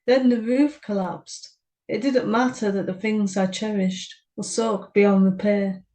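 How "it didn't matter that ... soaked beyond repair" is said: plain reading voice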